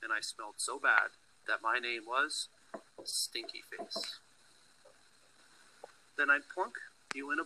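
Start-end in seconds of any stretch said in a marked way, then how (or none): 3.0-4.2 slow breathy whisper of the nickname. Acted. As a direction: whisper it slowly, like a secret. Child's teasing nickname whispered.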